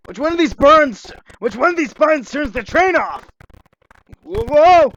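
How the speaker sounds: high, loud, frantic search; panicked. Acted. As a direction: panic as the train speeds up. Train speeding out of control.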